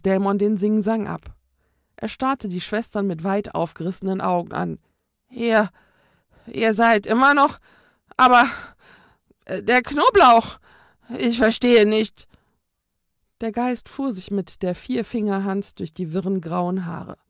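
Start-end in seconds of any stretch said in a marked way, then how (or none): 5.3-12.3 loud, stammering bewildered cry; stumble, the spell should have worked; baffled ghost. A narrator's reading. Ghost stunned the garlic has no effect, loud and stammering.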